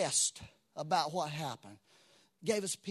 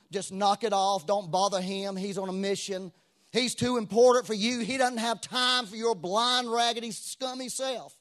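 loud, higher-pitched acted rebuke; mock scolding; 4 semitones higher, 8 dB louder than usual. Crowd shutting up blind Bartimaeus, loud mock scolding. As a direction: scold him harshly as the impatient crowd.

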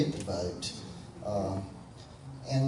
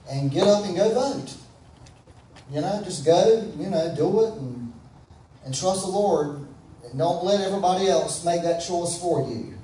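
louder, higher preacher's call to go vote; rousing exhortation; 4 semitones higher, 4 dB louder than usual. Acted. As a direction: fire the congregation up to act.

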